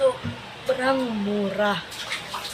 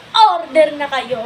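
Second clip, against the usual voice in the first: normal talking voice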